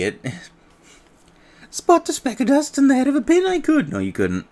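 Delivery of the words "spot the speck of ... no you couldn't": higher, loud, excitable little sidekick; high cheeky fairy voice. Acted.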